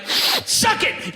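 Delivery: high shouted taunt; mock shout. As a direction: yell it out mockingly.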